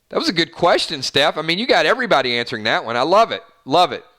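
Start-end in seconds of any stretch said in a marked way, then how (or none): none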